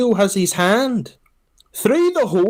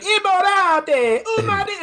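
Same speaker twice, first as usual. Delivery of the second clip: much higher, slow, hammed-up Italian yelling; mock Italian shouting; much slower than usual. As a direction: bellow at the driver like a Roman woman. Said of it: "she was shouting at him too".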